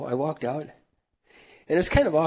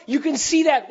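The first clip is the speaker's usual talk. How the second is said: loud, high preaching shout; emphatic shout; 8 semitones higher, 6 dB louder than usual. Real, not acted. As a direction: hammer it home again. Preacher repeats the urging.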